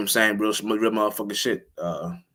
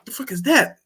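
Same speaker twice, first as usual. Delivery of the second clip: startled; 13 semitones higher, 5 dB louder than usual. Retold or imitated. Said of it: "I'm not gonna be like".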